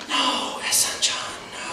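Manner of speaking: soft, whispered no from an imitated mom; imitated mother, hushed and disapproving; hushed motherly refusal. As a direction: refuse almost without voice, as a grave mother.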